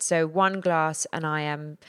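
usual speaking voice

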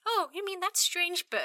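high mock townsperson; lightly voiced high bystander; townsfolk, high. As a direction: ask it high and puzzled. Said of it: "the people are just like".

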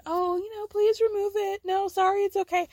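high, overly apologetic American voice; mock polite American. [name] mocking how Americans answer an insult, high and apologetic. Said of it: "Here's the thing with you guys Americans".

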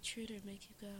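soft, sultry perfume-ad murmur; sexy ad voice. Retold or imitated. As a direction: purr it like a perfume commercial. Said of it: "that's exactly how I had to say it".

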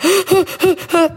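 high sharp panicked gasp; a child's frightened intake of breath; boy gasping at the blood. Retold or imitated. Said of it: "Så han bara".

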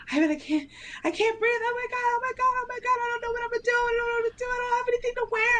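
high, panicked, breathless rush; freaking out. Retold or imitated. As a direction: squeal it out in a panic. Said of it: "I'm freaking out".

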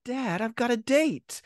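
put-on high, slow pleading teenage son; whiny son. Son begging his dad to let him out, in a high, slow whine. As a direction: plead like a sulky kid, drawn out. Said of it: "he goes".

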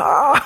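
high mock crowd scream; imitated crowd roar. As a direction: squeal like a cheering arena crowd. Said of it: "Och de bara". (hög, låtsad publikskrik)

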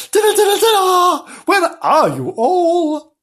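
very high, loud dinner call; long-drawn, high calling voice; Gillian. A narrator's reading. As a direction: summon them in a shrieky falsetto. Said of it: "Gillian calling them".

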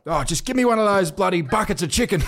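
hammed-up panicked dad at the drive-thru; panicked shout. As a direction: blurt it out like a flustered dad.

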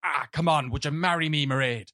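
mock old-Irish accent; put-on stage Irish. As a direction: lay on a hammed-up Irish brogue. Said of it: "That's the accent we put on".

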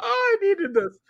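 high, loud laughter-wrung sigh; wiped out from laughing; laughing relief. Real, not acted. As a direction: still laughing, catching breath. After hard laughing.

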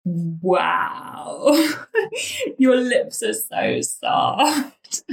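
high, very drawn-out, dissolving into laughter; laughing. Retold or imitated. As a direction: swoon and laugh through it. Recalling the first kiss, laughing.

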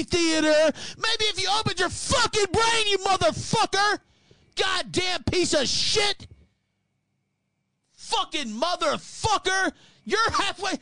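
screaming at a critic online about Lisbon; furious shout. Real, not acted. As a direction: tear into him at full volume. Ranting at a critic.

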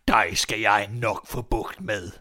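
slow, drawn-out threat; menacing old man. Acted. Old man promising to beat the boy, menacing. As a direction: draw the words out with menace.

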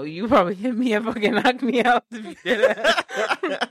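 giggly teasing; laughing tease. Real, not acted. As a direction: tease him while cracking up. Teasing about his strength.

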